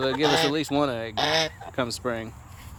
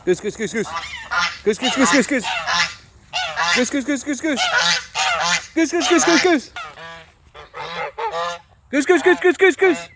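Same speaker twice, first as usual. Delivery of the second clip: goose call; 8 semitones higher, 6 dB louder than usual. Acted. Calling to the geese.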